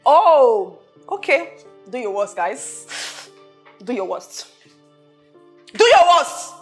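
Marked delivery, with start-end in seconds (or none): 1.9-4.5 low, hushed dare; quiet menace. Acted. Boss challenges the two workers, quietly menacing. 5.7-6.5 sudden forceful challenge; raised-voice dare. Acted. Boss challenges the two workers, voice raised.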